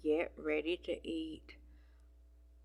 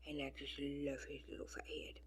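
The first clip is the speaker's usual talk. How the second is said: whisper; 12 dB quieter, faster than usual. Real, not acted. Cooing over fat kitten.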